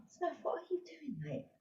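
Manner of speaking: very soft, high-pitched aside; aside to pet. Real, not acted. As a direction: quietly chide a pet in a high little voice. Chides pet Smurf mid-briefing.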